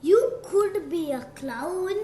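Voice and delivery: plain reading voice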